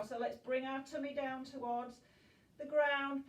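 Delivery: ordinary delivery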